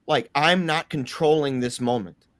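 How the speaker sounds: ordinary talk, nothing marked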